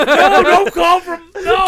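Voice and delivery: high, loud, laughing no; laughing protest. Real, not acted. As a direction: plead through laughter. Laughing protest, stop the call.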